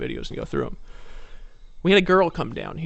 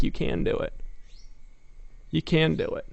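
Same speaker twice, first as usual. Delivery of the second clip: quiet coaxing, softer; soft encouragement; 6 dB quieter than usual. Retold or imitated. Coaching a scared woman on a ledge, softly. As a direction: gently urge her on. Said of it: "And I was like".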